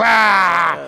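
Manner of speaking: loud drawn-out "rah"; imitated crowd roar. Mocking a crowd cheering a dumb bit, imitated roar. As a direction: roar like a whole audience erupting. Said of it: "Everyone goes".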